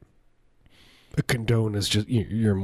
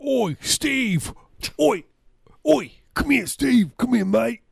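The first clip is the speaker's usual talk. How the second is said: very high-pitched British holler; British Bishop Nigel; 3 dB louder, slower than usual. Acted. British bishop calling Cardinal Steve over, exaggerated British accent. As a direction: yelp it in a silly mock British accent. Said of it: "Bishop Nigel and Cardinal Steve".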